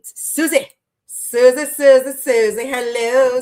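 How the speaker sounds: loud, repeated name call; excited calling out. Real, not acted. Host hailing a regular viewer joining the live, excited calling out. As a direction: call out the name loudly, over and over.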